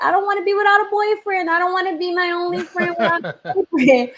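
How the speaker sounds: high, rushed imitation of a pleading girl; mock teenage protest. Teenage daughter protesting to her mother about having no boyfriend, mock high-pitched whine. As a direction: put on a high-pitched teen complaint.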